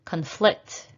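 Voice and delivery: Conflict with a weak cun, stressed FLICT; stress on second syllable. Shown as a demonstration. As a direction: lean on the end of Conflict, as a verb. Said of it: "practice shifting the stress".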